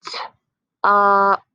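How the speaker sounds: slow blending sounds; slow sliding sounds. Shown as a demonstration. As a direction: slide through each sound, held long. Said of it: "slide through those sounds".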